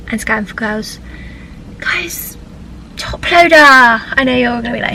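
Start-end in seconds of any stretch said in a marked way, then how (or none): 1.8-3.5 excited. Real, not acted. Thrilled about band playing nearby.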